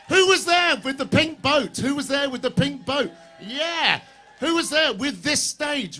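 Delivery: rally the audience with a grin; rally host calling to a cheering crowd. Real, not acted.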